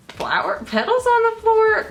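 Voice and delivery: high, rising mock-puzzled question; puzzled discovery. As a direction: voice climbs way up in wonder. Acting out her puzzled noticing.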